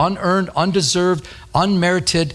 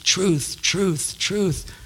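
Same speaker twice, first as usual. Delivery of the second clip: truth said three times, each softer and fading; repeated emphasis; 3 semitones higher, 5 dB quieter, much slower than usual. Shown as a demonstration. Preacher hammering the word truth, fading softer. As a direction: let each truth fall away quieter. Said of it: "keeps using the word truth".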